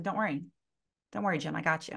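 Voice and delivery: retold soothing, steady reassurance; reassuring. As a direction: settle a flustered client down quietly. Reassures panicked client in retold call.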